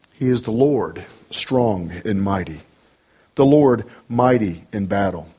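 low, measured phrases with long pauses; reverent, spacious recitation; solemn psalm reading. Acted. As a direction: let each line of the psalm stand alone. Reads psalm aloud.

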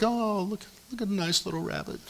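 high soft cooing over a rabbit. Retold or imitated. Pastor and wife admiring a rabbit, soft high coo. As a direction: coo sweetly at the bunny. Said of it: "and we were like".

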